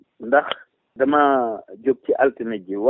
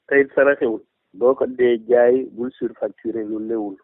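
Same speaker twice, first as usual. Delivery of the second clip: ordinary talk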